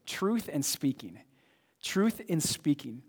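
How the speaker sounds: slow, deliberate, each word weighed; slow emphatic repeat. Shown as a demonstration. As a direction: pastor spelling out the two key words slowly. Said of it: "I wanna talk to you about two words".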